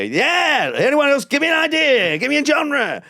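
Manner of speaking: high, loud call for suggestions; rowdy improv host. Retold or imitated. Improv host shouting to a stolen audience, hyped. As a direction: whip up the crowd at full pitch.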